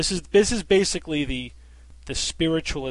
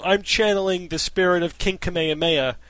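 louder, laughing mock channeler; 10 dB louder than usual. Skeptic mocking a channeler, louder laughing mock quote. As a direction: ham up a fake psychic while laughing. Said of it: "speak in a cheesy Hawaiian accent".

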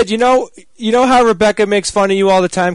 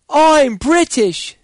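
mock British accent; 8 semitones higher, much slower than usual. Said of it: "going around telling people".

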